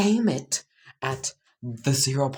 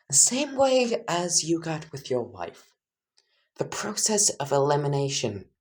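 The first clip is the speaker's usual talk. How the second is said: straight face; 5 semitones higher than usual. Acted. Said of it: "say this with a straight face".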